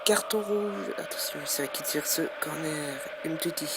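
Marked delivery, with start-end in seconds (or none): 0.0-0.9 loud, quick foul call; excited sports commentary. Real, not acted. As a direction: react hotly to the card. Foul and red card. (commentaire sportif animé)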